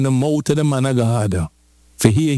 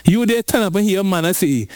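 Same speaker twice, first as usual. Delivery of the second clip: ordinary delivery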